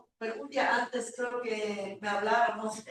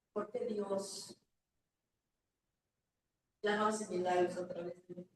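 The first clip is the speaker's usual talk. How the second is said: sorrowful questioning; 3 semitones lower, 4 dB quieter than usual. Retold or imitated. Retelling tearful doubt to Paquito.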